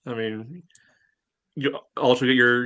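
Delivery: normal talking voice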